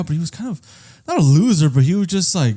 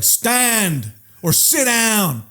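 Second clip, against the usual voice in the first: very slow, higher stretched-out order; mock command; 8 semitones higher, much slower than usual. Someone ordering him to stand or sit, slow mock command. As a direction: drag out the command like a drill sergeant.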